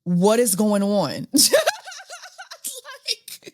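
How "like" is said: laughing. Real, not acted. Laughing at the texts.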